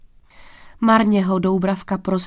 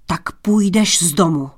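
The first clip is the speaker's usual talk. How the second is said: louder, restrained angry order; angry cry; 4 dB louder than usual. A narrator's reading. King throwing Tomáš out, angry cry. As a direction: banish him in anger, not at full shout. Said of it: "křikl zlostně král".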